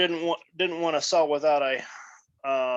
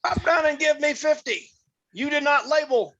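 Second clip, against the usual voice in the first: high-pitched mock drill-sergeant order; drill instructor; 11 semitones higher than usual. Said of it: "that act the drill instructor mode".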